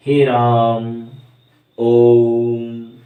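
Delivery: हे राम and ओम hugely drawn out, loud and level; triple-length vowels. Shown as a demonstration. Teacher demonstrating triple length, हे राम and ओम stretched very long and loud. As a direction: hold राम and ओम three times as long, like a steady chant. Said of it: "तिगुना समय लग रहा है".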